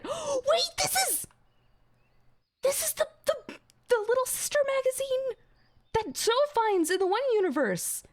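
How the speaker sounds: gasping, very high squeal of recognition; real high-pitched fangirl outburst; excited freak-out. Real, not acted. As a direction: stammer it out in a high, thrilled shriek. Streamer recognizes magazine from series.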